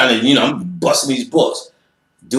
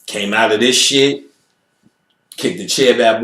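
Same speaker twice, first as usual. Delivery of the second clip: normal talking voice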